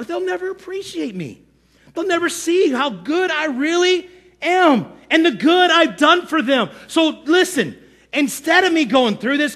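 self-pitying whine leaping high; Moses as victim. Acted. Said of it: "Moses had a serious victim problem".

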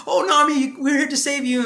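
high-pitched mock rescuer; gallant Sanji. Sanji telling Nami of the rescue, high mock gallantry.

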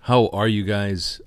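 announcer voice. Pretend. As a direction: open it like a stage host. Host opens the show.

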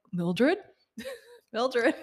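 questioning call, rising at the end; mock searching call broken by a laugh; puzzled husband dove. Husband dove calls for his missing wife, mock puzzled. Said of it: "And he's like".

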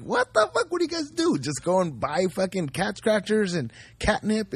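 high-pitched, incredulous mock reaction; mock incredulity. Retelling his mocking disbelief at friend.

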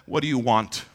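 hushed, low-voiced reply; gentle Jesus. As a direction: ask it gently and calmly. Jesus answers the blind man.

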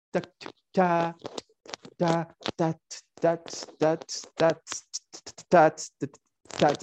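crisp percussive syllables in steady rhythm; imitated tambourine. Shown as a demonstration. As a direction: tap out a tambourine pattern with the voice. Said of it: "o con el pandero". (imitando el ritmo del pandero)

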